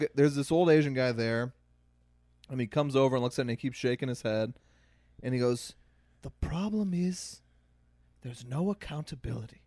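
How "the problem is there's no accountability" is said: an old man's voice with a hint of Scottish; light Scottish accent.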